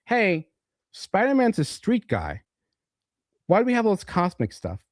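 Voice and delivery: high, mock outraged rant; outraged writers. Writers complaining about Mephisto, mock outraged rant.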